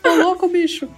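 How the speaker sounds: bordão de apresentador de TV empolgado; Faustão. Retold or imitated. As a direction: grita o bordão como o Faustão. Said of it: "Faustão estaria maluco aqui". (imitando o Faustão empolgado)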